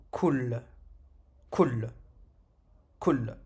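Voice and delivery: KUL ending on a bare L, no vowel after; stopped final letter. Shown as a demonstration. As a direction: land on the L of KUL and stop. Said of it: "On s'arrête, on retombe sur la lettre".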